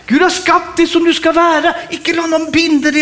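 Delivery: high, forceful pulpit delivery; impassioned preaching. Real, not acted. Preacher urging the congregation not to be bound, impassioned. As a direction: preach it with rising fervour.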